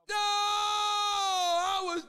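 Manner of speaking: sudden anguished shout of disappointment; real dismayed cry. Real, not acted. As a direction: yell out at the near miss. Wheel spin narrowly misses.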